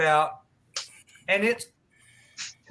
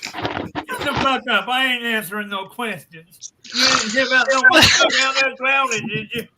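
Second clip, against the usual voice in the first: grumpy guy; 6 semitones higher, 6 dB louder than usual. Acted. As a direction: snap it like a sore loser. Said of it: "And he goes".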